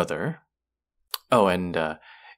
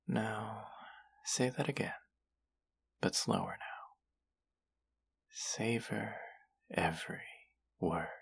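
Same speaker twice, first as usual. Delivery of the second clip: whisper; 2 semitones higher, 10 dB quieter than usual. Acted. Intimate teasing instruction.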